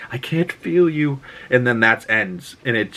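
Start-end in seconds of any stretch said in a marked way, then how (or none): 0.1-1.2 higher-pitched lightly acted grieving line; Wanda. Woman speaking to Vision's lifeless body, higher and grieving. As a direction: imitate her grief, pitched up. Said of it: "Wanda saying that to Vision's".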